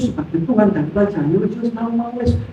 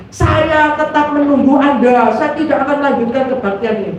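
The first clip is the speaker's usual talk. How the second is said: imitating a preacher, raised and insistent; pendeta; 4 semitones higher than usual. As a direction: hold out firmly from the pulpit. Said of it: "pendeta yang ngomong lagi". (menirukan pendeta yang bersikeras)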